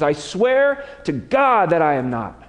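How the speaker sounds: a touch of a slow sworn denial; swearing an oath. Acted. Peter swearing to the crowd, mildly acted oath. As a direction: lean on God like a vow. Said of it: "Matthew tells us with an oath".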